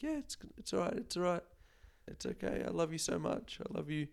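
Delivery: soft, high comforting hush to a pet; tender reassurance. Retold or imitated. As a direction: comfort the cat gently, almost breaking. Voicing owner soothing pet at vet.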